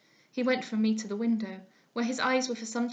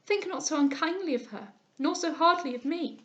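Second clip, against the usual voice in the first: pleading; 6 semitones higher, 3 dB louder than usual. A narrator's reading. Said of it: "cried I".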